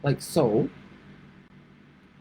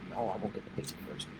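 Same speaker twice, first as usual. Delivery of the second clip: whispered aside; 9 semitones lower, 18 dB quieter, faster than usual. Real, not acted. Self-answer in quiet aside.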